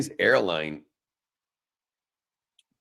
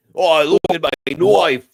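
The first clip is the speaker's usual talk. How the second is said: loud, higher hammed-up Australian accent; mock Australian accent; 6 semitones higher, 10 dB louder than usual. Joking with friends in a mock Australian accent. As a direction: ham up a cheeky Aussie. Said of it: "sounded a little bit more, uh, Australian".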